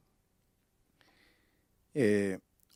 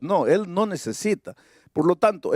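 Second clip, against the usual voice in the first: ordinary talk, nothing marked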